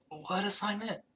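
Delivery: put-on quietly baffled, squinting drunk; befuddled drunk. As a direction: ask it in a hazy drunken stupor. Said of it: "his eyes are very much squinted".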